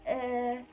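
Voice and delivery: high, noncommittal "eh"; mild contraction noise. Retold or imitated. Telling how the contraction level started, mild high-pitched "eh". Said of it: "the contraction level went from, like".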